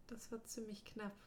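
breathy relieved whisper. Acted. Wounded friend murmuring after the battle, whispered. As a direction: let it out as a barely voiced whisper.

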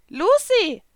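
a squeaky little cat calling her name; Happy calling Lucy. A narrator's reading. The little blue cat calling Lucy, high and loud.

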